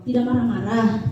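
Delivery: ordinary delivery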